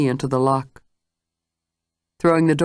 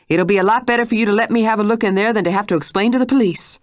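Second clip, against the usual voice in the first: higher, faster insistent voice; pressing demand; 4 semitones higher, faster than usual. Acted. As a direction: press her hard. Mrs Stitt presses Jane.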